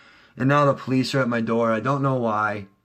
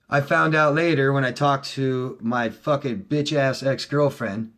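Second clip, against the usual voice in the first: normal talking voice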